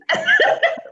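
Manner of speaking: sudden high laugh; high burst of laughter. Real, not acted. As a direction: laugh out loud. Joking about morning breath.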